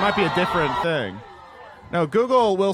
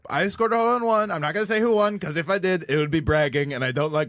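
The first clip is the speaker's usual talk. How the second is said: higher-pitched mock-modest politician; coy boasting impression, pitched up; Trump; 3 semitones higher than usual. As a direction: humblebrag in a comic statement voice. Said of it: "Did you guys see his one today".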